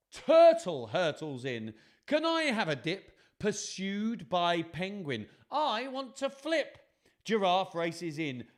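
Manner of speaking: excited storytelling with high animal cries; dramatic picture-book narration. A narrator's reading. Telling children of animals racing in, excited dramatic reading. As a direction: build the chaos, stretching the big moments.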